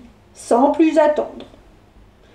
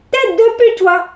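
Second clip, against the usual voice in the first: cheeky insult; 7 semitones higher than usual. Acted. Bewitched insult.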